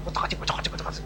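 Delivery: hushed, whispered tinkering; furtive whisper. Retold or imitated. Preacher miming a thief tinkering with a motorbike, furtive whisper. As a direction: whisper it under your breath like a sneaking thief. Acting out a stealthy motorbike theft.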